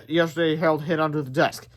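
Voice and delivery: usual speaking voice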